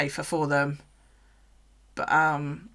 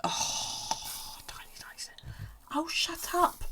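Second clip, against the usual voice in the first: whisper; 11 semitones higher, 6 dB quieter, slower than usual. Real, not acted. Fond whispers to the snake.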